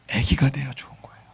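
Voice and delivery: 애기가 돼야 좋은 거예요 whispered softly; whispered echo. Shown as a demonstration. Pastor echoing the point to the congregation in a whisper. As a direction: repeat it in a whisper. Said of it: "나이가 많아도 ... 애기가 돼야".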